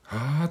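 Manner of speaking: high breathy surprised gasp; startled gasp. Shown as a demonstration. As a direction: gasp like something startled you. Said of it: "言いたいだけになってます".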